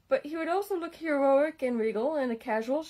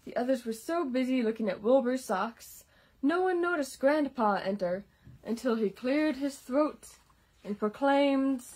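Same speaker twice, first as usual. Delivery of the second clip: ordinary delivery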